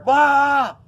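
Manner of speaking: high, loud scream of pain. Retold or imitated. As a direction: yell out like the IV burns like fire. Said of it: "So I'm screaming".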